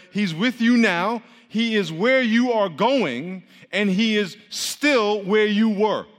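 slow each phrase down, deliberate; slow preacher's emphasis; slow, weighty emphasis. Acted. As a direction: lay it out slowly like a revelation.

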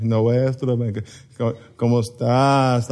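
low, sing-song Mexican Spanish with drawn-out vowels; melodic Mexican Spanish. Shown as a demonstration. Preacher shows the congregation how Mexicans speak, melodic Mexican Spanish. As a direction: stretch it out like sung Mexican Spanish. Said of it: "Mexican Spanish is like you're singing".

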